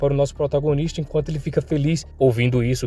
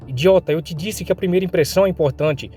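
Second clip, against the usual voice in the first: higher-pitched scolding; exasperated, acted girl's scolding; aggressive; 4 semitones higher than usual. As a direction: tell him off in a higher voice. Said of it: "ouvindo ela sendo agressiva".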